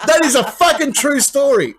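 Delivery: high, loud, incredulous swear it's true; excited insistence. Real, not acted. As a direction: sell it as unbelievable but real. Insisting after wild story.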